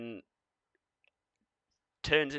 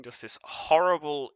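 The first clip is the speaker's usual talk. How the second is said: ordinary talk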